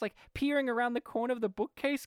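ordinary talk

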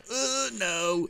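high robotic no; high pitched mock machine; mock calculator. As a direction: calculator refusing. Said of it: "It goes".

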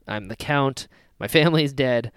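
ordinary delivery

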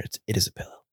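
soft, unvoiced correction to herself; whispered aside. Real, not acted. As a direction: whisper it as a side note.

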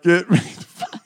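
high mock plea breaking into laughter; laughing mock plea.

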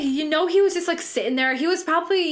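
ordinary talk, nothing marked

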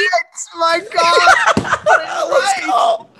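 yelling high with joy; triumphant shout. Real, not acted. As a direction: cheer it out loud. Shouting in triumph after correct guess.

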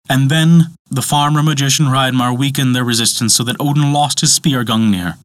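plain reading voice

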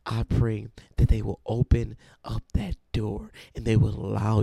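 loud preaching prayer, building in force; preacher's prayer rising to a loud, fervent pitch. Acted. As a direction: pray it out with full fervor.

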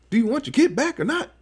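high, fast hammed-up yell; mock yell. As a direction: act out a frantic yell, half-joking. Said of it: "And he's yelling and stuff".

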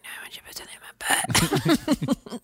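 voiceless, secretive offer; mock whisper. Host voicing Homer to Marge in bed, whispered. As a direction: whisper it across the pillow. Voicing own reply in bedtime bit.

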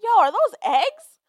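high, loud, slowed disbelief; shocked. Retold or imitated. At work, spotting eggs in the turtle tank, stunned. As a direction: call it out in stunned alarm. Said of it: "I said".

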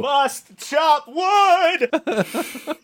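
very slow, high mock-heroic cry; hammed-up woodchopper. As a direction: strain out each word, drawn long, as a cartoon urge. Acts George Washington with cherry tree.

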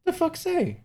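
put-on very high little-kid voice; imitating a toddler. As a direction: squeak it out like a three-year-old. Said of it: "And he goes".

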